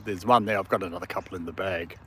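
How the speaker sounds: usual speaking voice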